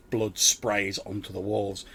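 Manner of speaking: ordinary talk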